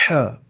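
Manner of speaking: "ہا" with a long, strong rush of air from mid-throat. Shown as a demonstration. Teacher showing the middle-throat letter, breathy ha demo. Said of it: "جیسے".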